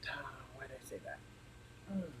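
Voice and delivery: fast whispered aside; whispered regret. Real, not acted. Vlogger whispers regret after oversharing about chickens. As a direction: hiss it to yourself in regret.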